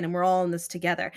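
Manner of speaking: ordinary talk, nothing marked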